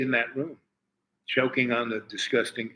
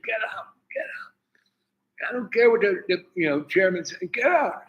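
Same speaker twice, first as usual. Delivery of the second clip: retold screaming; 8 semitones higher than usual. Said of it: "starts screaming".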